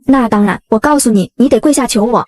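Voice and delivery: usual speaking voice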